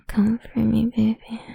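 usual speaking voice

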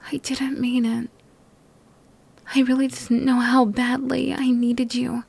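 ordinary talk

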